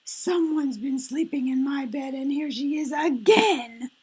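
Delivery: put-on high-pitched baby bear wail; tiny bear crying out in dismay. A narrator's reading. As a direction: wail it high and upset like a little cub. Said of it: "he wailed".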